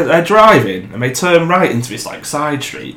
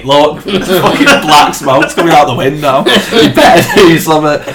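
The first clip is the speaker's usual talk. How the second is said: laughing urgency; 3 semitones higher, 4 dB louder than usual. Retold or imitated. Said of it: "I'm like".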